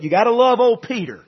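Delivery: ordinary talk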